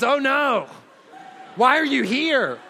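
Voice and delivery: shocked wife half laughing at the kitchen table. Retold or imitated. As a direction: play her caught out and horrified, half laughing. Wife shocked to see husband.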